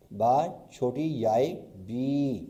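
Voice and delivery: slow, deliberate: با چھوٹی یائے, then بی; slow spelling out. Shown as a demonstration. Teacher to students, slowly spelling بی. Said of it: "صحیح لکھنے کا طریقہ".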